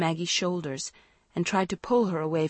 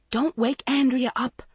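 higher urgent call; calling after; 7 semitones higher, 3 dB louder than usual. A narrator's reading. Mother to daughter running off, urgent call. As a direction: call after someone hurrying off. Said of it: "Missus Travers called behind her".

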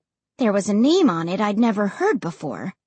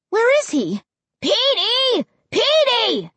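high, drawn-out calling; shrill, anxious shout; shrill and desperate; 12 semitones higher, 3 dB louder than usual. A narrator's reading. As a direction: call desperately for a lost dog. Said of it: "sounding shrill and desperate".

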